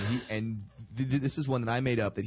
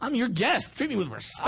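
higher, indignant mock protest; mock offended; 11 semitones higher than usual. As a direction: play the wounded guest for laughs. Mock offense after accent jab.

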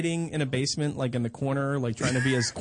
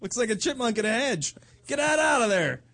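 mock disgusted kid; 8 semitones higher, 3 dB louder than usual. Kid voiced about dad's pubes.

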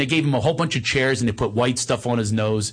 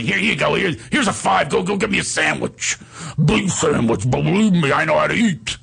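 mock bossy blusterer, higher pitch; Steiny; 3 semitones higher than usual. Actor sends his runner for food, bossy impression. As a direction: order the errand in an imitated bossy voice. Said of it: "bosses him around".

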